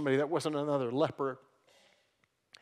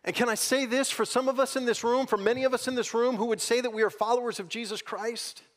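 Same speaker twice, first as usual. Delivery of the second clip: ordinary talk, nothing marked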